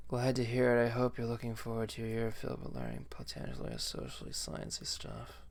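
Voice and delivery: soft, lazy stoner teacher; lightly voiced mellow stoner. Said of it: "It's my best stoner voice".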